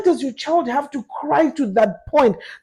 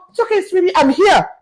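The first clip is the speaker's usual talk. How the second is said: soothing mommy voice; 7 semitones higher than usual. Retold or imitated. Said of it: "all they want is mommy to go".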